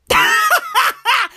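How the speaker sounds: high-pitched burst of laughter; laughing. Real, not acted. Comedian cracks up at performing in a circle, high laugh.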